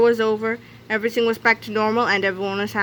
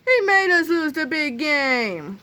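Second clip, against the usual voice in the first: slow, higher-pitched complaint; accusing cry; 6 semitones higher, slower than usual. A narrator's reading. Football team blames Melvin for their loss, drawn-out cry. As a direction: stretch out the grievance. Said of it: "cried the entire football team simultaneously".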